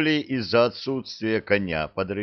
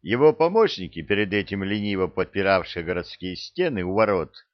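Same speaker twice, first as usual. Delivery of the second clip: ordinary delivery, nothing marked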